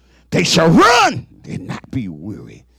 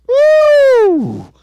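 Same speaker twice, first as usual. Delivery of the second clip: high loud woo; sharp ecstatic preacher cry; high whoop; 4 dB louder than usual. Real, not acted. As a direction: let out a joyful whoop. Preacher's shout of fervor.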